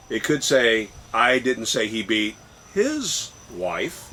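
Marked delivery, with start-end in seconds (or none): 1.1-4.1 "his" set apart by pauses and pitched up; pause, then lean on "his"; stress on HIS. Shown as a demonstration. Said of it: "depending upon where you put the emphasis".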